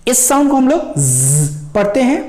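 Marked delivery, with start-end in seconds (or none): 1.0-1.7 "ज" as a sustained English z; buzzing z sound. Shown as a demonstration. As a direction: hold a buzzing zzz for "ज". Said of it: "इस sound को हम लोग normal ज". ("ज" को "ज़्ज़" जैसा)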